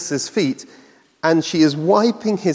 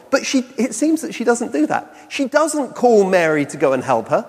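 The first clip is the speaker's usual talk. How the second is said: normal talking voice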